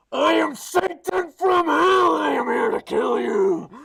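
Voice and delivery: huge, slow roar; demonic Satan voice. Acted. Girlfriend turned Satan threatening him, monstrous slow shout. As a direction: bellow it like a demon from hell. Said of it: "maybe it gets really low".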